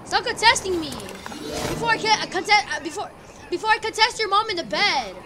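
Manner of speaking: loud trash-talk yelling; angry shout. Real, not acted. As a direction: yell it at the enemy. Enemies landing, angry.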